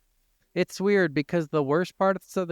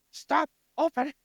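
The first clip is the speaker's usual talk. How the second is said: much higher mock shout at the door; pitched-up, hammed-up frustrated command; exasperated yell; 13 semitones higher than usual. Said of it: "sitting there like".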